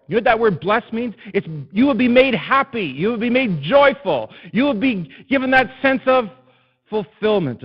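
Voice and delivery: loud, high, driving sermon voice; full-force preaching. Acted. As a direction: preach it, building to the Lord's questions.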